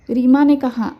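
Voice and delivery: ordinary delivery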